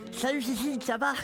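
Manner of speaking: light cartoon sloth voice; a hint of Sid the sloth; Sid impression. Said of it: "Sid dans L'âge de glace".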